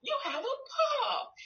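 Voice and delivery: high, slow cheering yay with a laugh; praise. Shown as a demonstration. Therapist modeling praise for a parent, high and delighted. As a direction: celebrate the child's word. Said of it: "You give them praise... You say".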